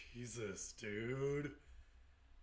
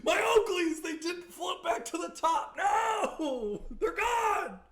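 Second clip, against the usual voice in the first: much higher and louder, hammed-up crying shout; mock wailing shout; 8 dB louder, much slower than usual. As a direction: wail like a heartbroken old man. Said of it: "He's like".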